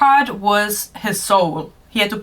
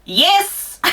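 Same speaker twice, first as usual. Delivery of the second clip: high eager yes; 12 semitones higher than usual. Acted. Character eagerly answering the narrator, high excited yes.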